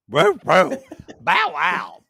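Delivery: playful woof woof; barking. Pretend. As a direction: bark like a little dog. Calls her a dog.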